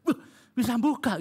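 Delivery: high mock-delighted exclamation; retold delight. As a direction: act out a wife thrilled that it works. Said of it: "Wah, dia senang sekali begitu".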